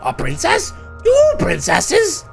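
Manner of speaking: high, slow princess voice; cartoon princess. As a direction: sing it like a storybook princess. Reacting to the princess splitting.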